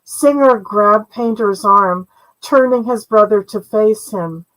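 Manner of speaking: usual speaking voice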